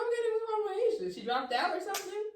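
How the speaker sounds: high-pitched, hurried guessing; anxious questioning. Retold or imitated. Sister presses family about Asia, high and anxious. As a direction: press them in a high, quick voice.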